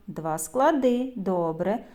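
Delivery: ordinary talk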